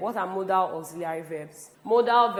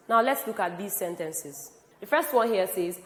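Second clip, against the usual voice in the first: normal talking voice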